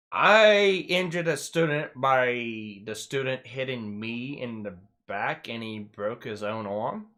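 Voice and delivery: slow, drawn-out disbelief; incredulous echo. Retold or imitated. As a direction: spell out the absurdity, stretching it slowly. Echoes accusation back in disbelief.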